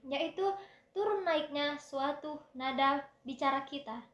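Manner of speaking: normal talking voice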